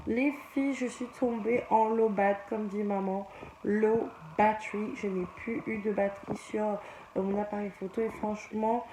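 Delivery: soft, hushed bedtime voice; quiet bedtime talk. Real, not acted. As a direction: talk softly so as not to wake anyone.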